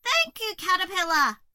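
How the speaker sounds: high, loud grateful shout; thrilled cry. A narrator's reading. Cockroach thrilled by Hazel's gift, joyful cry. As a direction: cry out your thanks. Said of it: "He cried".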